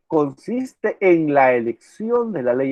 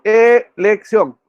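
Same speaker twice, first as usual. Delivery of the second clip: "elección" drawn out slowly, higher and louder; emphatic repeat; 4 semitones higher, 5 dB louder, much slower than usual. Shown as a demonstration. Teacher hammering the key word "elección" to students, slow and loud.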